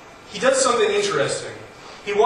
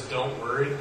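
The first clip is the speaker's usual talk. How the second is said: hollow reassurance; 5 semitones lower, 6 dB quieter than usual. Retold or imitated. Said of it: "It's kind of a, a hollow hope".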